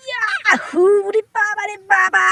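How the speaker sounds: high, loud comic wail; pained yelp. Acted. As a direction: shriek as if jabbed from behind. Yelping after being poked.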